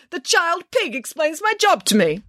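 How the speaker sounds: high, slow, incredulous outrage; theatrical, offended official's voice; affronted. Acted. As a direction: spell out the insult as a pompous director. Affronted director mocking the girl.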